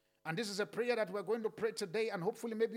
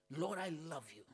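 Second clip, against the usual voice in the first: much lower and softer, intimate; tender whisper of love; 6 semitones lower, 7 dB quieter than usual. Real, not acted. Pastor praying tenderly to God, hushed. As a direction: say it like to a loved one, hushed. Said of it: "Say it like you mean it".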